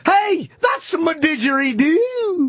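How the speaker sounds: high, slow cartoon Australian accent; mock Aussie accent. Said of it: "You want some more Aussie accents".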